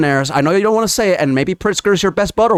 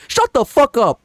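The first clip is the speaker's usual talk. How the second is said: angry retort; 7 semitones higher than usual. Real, not acted. Telling off billionaires.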